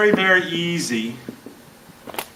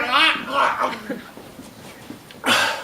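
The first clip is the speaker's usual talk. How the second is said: mock gag; 8 semitones higher, 5 dB quieter, much slower than usual. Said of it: "it's like".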